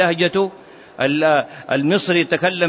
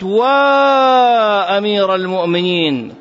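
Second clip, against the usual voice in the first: a long, loud, drawn-out wail of grief; crying out; 3 semitones higher, 4 dB louder, slower than usual. Retold or imitated. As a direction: call it out slowly like a mourning cry across the rooftops. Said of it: "صاحت جارية فقالت". (صرخة نعي طويلة عالية)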